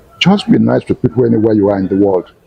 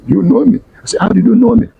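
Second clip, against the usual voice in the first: genuinely taken aback; high incredulous query; surprised question; 12 semitones higher than usual. Retold or imitated. Teacher surprised at recognition.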